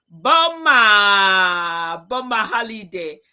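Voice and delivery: loud drawn-out call of a name. Real, not acted. Host spots Boma in the meeting, loud drawn-out call.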